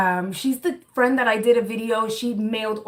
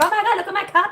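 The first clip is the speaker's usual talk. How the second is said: mock friend; 11 semitones higher, 4 dB louder, much faster than usual. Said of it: "he'll be like".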